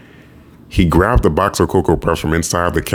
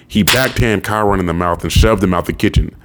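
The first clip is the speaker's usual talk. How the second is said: ordinary delivery, nothing marked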